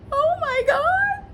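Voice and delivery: very high, slow, breathy sob-sigh; overcome, near tears. Real, not acted. Moved by Matt's video, breaking into tears. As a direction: let the words melt into a teary sigh. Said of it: "let's move on before I cry".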